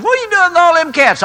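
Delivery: high, fast questioning; mock baffled neighbor. Neighbor asks about all the cats, mock bafflement. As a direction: imitate an incredulous neighbor.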